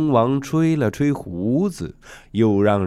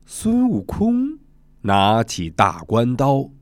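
usual speaking voice

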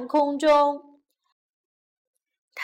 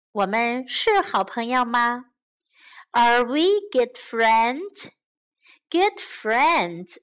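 plain reading voice

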